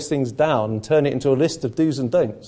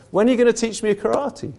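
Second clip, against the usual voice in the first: exasperated, higher pitched demand; cross pupil; 8 semitones higher than usual. Retold or imitated. Frustrated pupil demanding his master finally teach him. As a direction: ask it fed up and impatient. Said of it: "he gets really cross".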